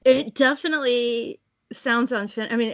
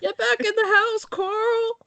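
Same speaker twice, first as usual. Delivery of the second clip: high wailing Southern call for Carl; fake Southern accent; 10 semitones higher, slower than usual. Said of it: "fake Southern accent".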